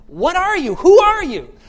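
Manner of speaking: high, loud, emphatic double question; astonished questioning. Acted. People asking about Jesus, loud amazed demand. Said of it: "people are constantly asking".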